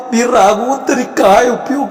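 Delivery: ordinary talk, nothing marked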